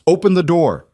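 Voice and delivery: shouting. A narrator's reading. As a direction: yell through the door. Pounding on the door, calling.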